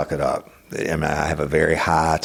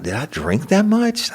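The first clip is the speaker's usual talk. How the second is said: pitched way up in disbelief; high incredulous. Retold or imitated. Hungover man asking himself, high disbelieving squeak. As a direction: squeak it out, can't believe it.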